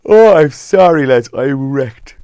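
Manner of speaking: talking through a big yawn. Real, not acted. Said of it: "Oh, excuse me, I'm yawning".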